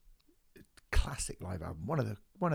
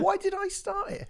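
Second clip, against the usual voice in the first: mock puzzled squeak.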